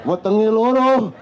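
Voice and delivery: loud, drawn-out "loro" in a mocking Madurese accent. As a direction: imitate a Madurese father complaining, Madurese accent. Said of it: "orang Madura". (logat Madura yang dibuat-buat)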